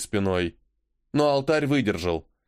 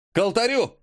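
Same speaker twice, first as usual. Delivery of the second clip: rally them toward the altar; shouted command; 9 semitones higher than usual. Acted. Commander orders charge in battle.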